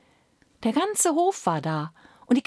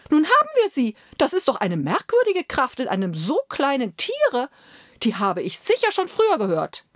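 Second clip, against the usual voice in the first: high, loud eager courtiers; excited courtiers; 6 semitones higher, 3 dB louder than usual. Acted. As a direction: courtiers sure they have found it, thrilled. Courtiers voiced in story.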